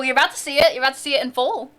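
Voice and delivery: ordinary talk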